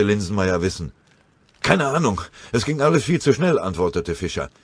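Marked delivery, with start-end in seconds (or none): 1.6-3.6 gehetzt. Acted. Atemloser Oberfeldwebel nach Gefecht.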